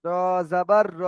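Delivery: no stretch on the last র; short final vowel. Shown as a demonstration. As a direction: spell র জাবার, then a brief final র. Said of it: "এখানে টান হবে না".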